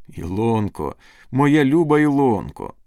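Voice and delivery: joyful endearment. Acted. Lover pulling his sweetheart close, ardent joyful cry. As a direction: call out the beloved's name with rising ardour.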